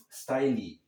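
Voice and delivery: "style" said as "STY-lee"; "style" with an extra final ee syllable; mispronounced style. Retold or imitated. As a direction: imitate the learner's error, adding ee to "style". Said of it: "Ele fala style".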